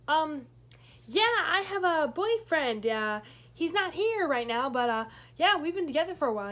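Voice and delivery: nervous lie. Retold or imitated. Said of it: "I went".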